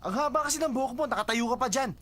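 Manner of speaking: very high, loud flustered complaint; startled protest. Acted. Explaining the fright to the long-haired friend, startled protest. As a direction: protest at high pitch, still spooked.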